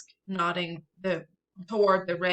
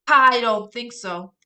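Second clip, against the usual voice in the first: loud, slow stern no; big sister shouting him down; firm shout; 3 semitones higher, 10 dB louder, slower than usual. Acted. Big sister stops brother.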